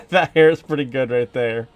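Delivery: laughing. Real, not acted.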